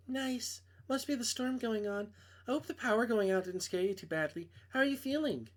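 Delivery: a hint of a young woman on the phone; girl voice. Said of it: "I can't do girl voices".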